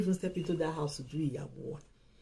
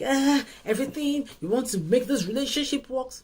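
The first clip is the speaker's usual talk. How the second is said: higher-pitched mock eagerness; over-enthusiastic; 6 semitones higher than usual. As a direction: imitate someone trying far too hard. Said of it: "when you're too enthusiastic".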